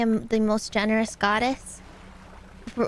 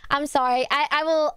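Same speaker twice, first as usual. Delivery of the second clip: ordinary delivery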